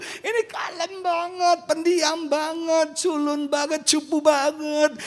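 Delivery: high, drawn-out protest; mock whining complaint. Pastor protests the Spirit's choice, high mock whine. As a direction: stretch each complaint out in a high voice.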